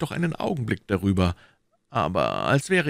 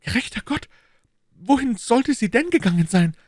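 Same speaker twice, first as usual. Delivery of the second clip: higher, louder nervous protest; anxious Bonacieux; 5 dB louder than usual. Acted. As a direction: plead his innocence. (ängstlicher Bonacieux)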